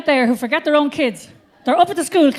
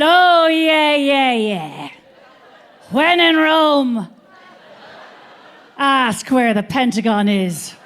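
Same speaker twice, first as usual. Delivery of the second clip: a lightly put-on, dreamy old mother, slow; mock mother voice; 5 dB louder, much slower than usual. Mother airily answering her daughter, mock voice. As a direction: play the vague mother, loud at first then fading.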